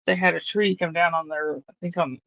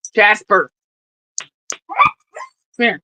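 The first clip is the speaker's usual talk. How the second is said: loud call for the dog; 4 semitones higher, 8 dB louder than usual. Real, not acted. Calling the dog.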